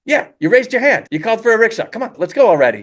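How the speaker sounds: high, insistent cabbie-like urging; impatient driver. Acted. Rickshaw driver to confused Po, impatient and insistent. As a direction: hurry the customer along, high-pitched.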